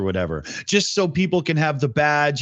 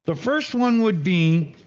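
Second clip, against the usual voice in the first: talking around a cigarette; words slurred by a cigarette; cigarette in mouth; 5 semitones higher than usual. Real, not acted. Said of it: "I'm talking with a cigarette in my mouth there".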